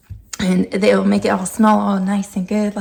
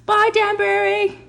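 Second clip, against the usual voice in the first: much higher sing-song bye; high dog farewell; 10 semitones higher than usual. Real, not acted. Owner waving off her dog, high sing-song.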